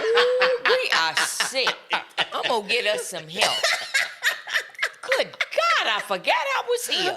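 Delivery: high, laughing through the words. Real, not acted. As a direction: crack up while talking. Laughing hard after singing.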